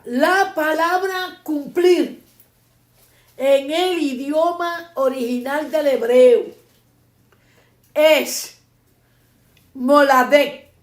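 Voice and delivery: slow, emphatic note-taking pace; deliberate teacher dictation, firmly projected. Real, not acted. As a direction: dictate it phrase by phrase with pauses. Dictating for students taking notes.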